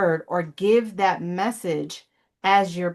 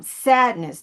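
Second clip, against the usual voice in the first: ordinary delivery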